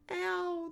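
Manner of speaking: high, loud yelp of "ow"; drawn-out high wail of pain; pained cry. A narrator's reading. Little boy swiped on the nose, crying out. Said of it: "the little boy cries".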